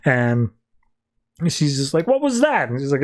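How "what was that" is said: high-pitched, put-on "what?!"; lightly acted incredulous question; mock bafflement. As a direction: act out a startled demand. Retold quarrel, baffled character.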